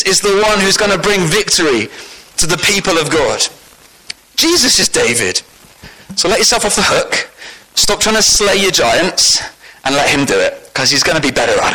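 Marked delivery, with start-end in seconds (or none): none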